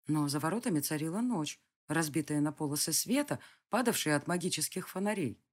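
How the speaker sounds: plain reading voice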